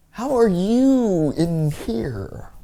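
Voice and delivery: high, slowly stretched disbelief; incredulous. Retold or imitated. Teller to night-terror guest next morning, drawn-out incredulity. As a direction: draw each word out high, baffled.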